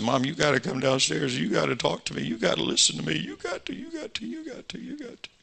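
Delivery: high, breaking, fading sob as he begs; sobbing plea. Retold or imitated. Young man crying, begging his mom to listen, breaking sob. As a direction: beg through tears until the voice squeaks away.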